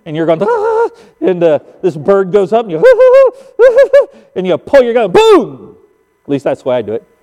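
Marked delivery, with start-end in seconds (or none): 0.4-0.9 high-pitched startled cry; startled gasp. Acted. Hunter startled by a rising pheasant, startled gasp. 3.6-4.0 high, loud flustered hoots; rapid breathy whoops of a startled hunter; mock panicked panting. Hunter flustered as the bird goes up, mock panicked panting. 5.1-5.8 loud, booming shotgun blast; imitated gunshot. Hunter fires at the rising bird, imitated gunshot.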